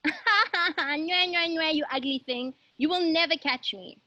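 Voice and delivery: high sing-song taunt; girl teasing the monster from her hole, playful taunt. Acted. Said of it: "teases him again".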